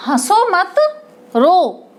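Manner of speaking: "हंसो मत" as one unit, break, then "रो"; pause after मत. Shown as a demonstration. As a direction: hold a comma pause after "मत" before "रो". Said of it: "ऐसे ही".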